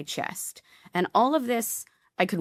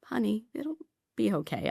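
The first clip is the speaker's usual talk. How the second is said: soothing orderly; 6 semitones higher, 4 dB quieter than usual. Retold or imitated. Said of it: "And he was like".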